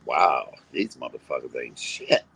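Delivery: low, dismissive muttered verdict; unimpressed inner thought. Retold or imitated. To the channel, retelling her reaction to the bastards, low and dismissive. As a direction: shrug it off as worthless. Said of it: "I was just like".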